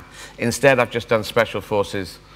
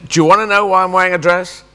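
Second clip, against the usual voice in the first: confronting challenge; 7 semitones higher, 5 dB louder than usual. Retold or imitated. Confronting the teasing girls.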